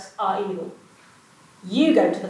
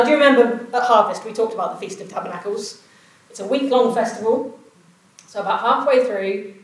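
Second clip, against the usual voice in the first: ordinary talk, nothing marked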